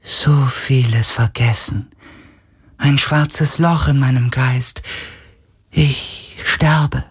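slow, high, ghostly lament; drawn-out, weak sorrow; dying spirit voice. A narrator's reading. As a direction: speak as a fading, otherworldly being.

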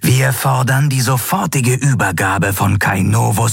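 measured, hissed ultimatum to a ship; hissing disembodied voice. A narrator's reading. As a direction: deliver the threat as a bodiless voice. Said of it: "zischte die körperlose Stimme unbeirrt".

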